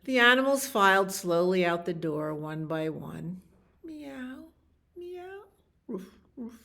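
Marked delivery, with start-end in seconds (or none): 3.8-5.4 soft, high, small mews; cat meow. Acted. Cats filing slowly out the door, soft high meows. 5.9-6.6 soft, raised little woofs; dog woof. Acted. Dogs filing slowly out the door, soft high woofs.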